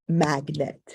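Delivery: "magnet" in two measured beats, mag, net; syllable by syllable. Shown as a demonstration. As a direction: sound out both syllables of "magnet". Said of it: "words with more than one syllable".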